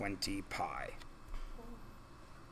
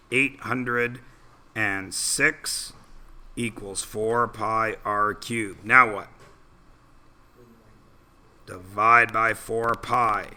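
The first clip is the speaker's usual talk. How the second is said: normal talking voice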